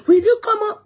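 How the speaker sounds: high, loud mock telling-off; retold father's scolding. Daughter imitating her daddy's rebuke, loud scolding. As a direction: imitate dad cutting her off, pitched right up. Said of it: "My daddy just said".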